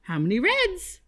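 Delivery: ordinary delivery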